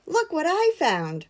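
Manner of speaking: high excited call. A narrator's reading. Little bear calling to edith, high and excited. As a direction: call out to share a find. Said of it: "called Little Bear".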